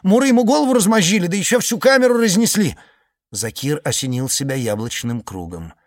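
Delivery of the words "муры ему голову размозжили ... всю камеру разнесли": high, loud, agitated telling; excited high report. Acted. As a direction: blurt it out, worked up. Iceman continues report.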